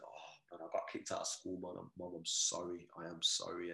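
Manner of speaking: low, soft, contrite confession; subdued apology. Retold or imitated. Son tells mum he got kicked out of school, quietly apologetic. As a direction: retell owning up, voice dropped and sheepish.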